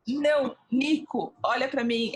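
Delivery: a touch of retold insistence; insistent refusal. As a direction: make him look at you, slowly. Refusing the director in story.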